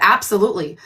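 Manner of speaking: usual speaking voice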